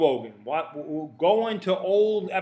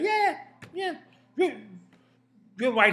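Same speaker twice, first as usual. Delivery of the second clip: high, easygoing agreeing; Bill Burr impression; 8 semitones higher, 3 dB louder than usual. Bill Burr agreeing with the interviewer, amused. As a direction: roll with it, amused. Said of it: "Bill Burr would probably say".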